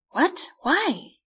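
high, startled questioning; alarmed questions. A narrator's reading. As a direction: ask it startled, pitched right up. Alarmed at cancelled flight news.

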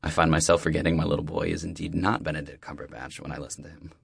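ordinary delivery, nothing marked

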